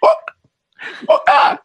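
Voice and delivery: unvoiced gasps, trying to ask for a coke; no air to speak; airless choking gasps. Choking man gasps at the taquero for a coke. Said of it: "le digo".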